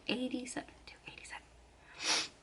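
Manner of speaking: unvoiced whisper, repeating the number; whispered to self. Real, not acted. Reminding herself of the number in a whisper. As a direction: whisper it to yourself so you don't forget.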